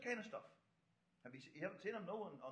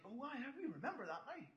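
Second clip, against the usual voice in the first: higher, softer voice of sudden remembering; dawning realisation; 5 semitones higher, 7 dB quieter than usual. Acted. Preacher voicing the women at the tomb, dawning realisation. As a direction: let the penny drop, pitch swooping. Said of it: "the penny starts to drop with the ladies".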